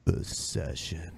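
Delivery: deep, drawn-out sultry voice; low, slow catchphrase. Pretend. Host dropping low and slow for the running gag. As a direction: drop the voice low and drag it.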